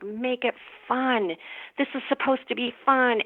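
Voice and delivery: ordinary talk, nothing marked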